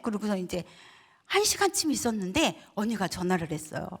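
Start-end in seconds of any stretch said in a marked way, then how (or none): none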